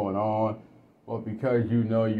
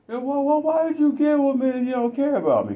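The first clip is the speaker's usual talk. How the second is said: much higher, pleading wife; wife's voice; 3 dB louder, faster than usual. Retold or imitated. Wife asking her husband why he married her, high pleading.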